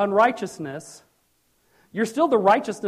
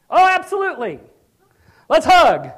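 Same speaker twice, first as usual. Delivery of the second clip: high, loud, delighted absolutely; God gladly welcoming back a sinner; joyful welcome; 8 semitones higher, 10 dB louder than usual. Pretend. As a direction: throw your arms open with joy.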